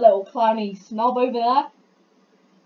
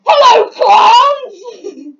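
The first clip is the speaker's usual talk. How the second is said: slow, loud stretched shout of hello; over-the-top greeting; 10 semitones higher, 14 dB louder, much slower than usual. Pretend. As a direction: belt the greeting like a hyped streamer. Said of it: "but then there's me".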